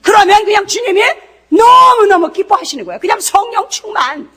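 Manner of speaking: loud, high preacher's cry; fiery preaching. Acted. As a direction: drive it home to the congregation. Preaching at full force. (힘차게 외치는 설교)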